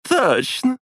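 high giggling agreement; drunken giggle. A narrator's reading. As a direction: giggle it out tipsily. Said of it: "пьяно хихикнула Краснова".

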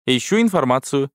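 ordinary delivery, nothing marked

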